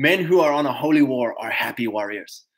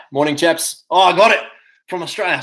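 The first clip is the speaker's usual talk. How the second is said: normal talking voice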